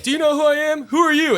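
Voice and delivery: mock the self-important guy's outrage; hammed-up who-do-you-think-you-are shout; indignant entitled customer. Entitled customer confronting bartender.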